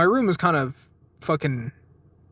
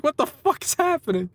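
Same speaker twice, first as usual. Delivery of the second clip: high-pitched retold bewilderment; acted-out incredulous question, pitched way up; baffled disbelief; 11 semitones higher than usual. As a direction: squeak it out, replaying total confusion. Retelling own bewilderment at chanting.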